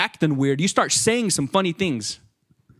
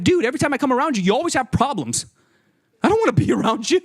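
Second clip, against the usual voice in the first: fast, higher exasperated complaint; fed-up friend; 6 semitones higher, faster than usual. Retold or imitated. Friend fed up with a negative guy, fast exasperated complaint. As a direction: rattle it off, sick of him.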